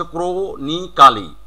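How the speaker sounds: ক্রোনিকালি slowly split as "kro-ni-KA-li"; syllable-by-syllable. Shown as a demonstration. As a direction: break ক্রোনিকালি into syllables with a full "-ka-li". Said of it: "syllable ভাগ করে".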